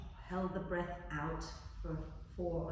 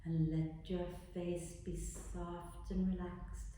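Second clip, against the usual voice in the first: guided meditation; 8 dB quieter than usual. Acted. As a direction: guide them gently into relaxing. Leads a body relaxation.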